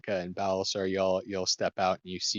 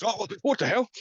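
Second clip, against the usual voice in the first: high, surprised outburst; a startled yelp of disbelief; startled rider; 13 semitones higher, 4 dB louder than usual. Acted. Rider startled by a voice in his head, high and surprised. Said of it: "you hear back in your own voice".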